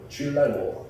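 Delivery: ordinary talk, nothing marked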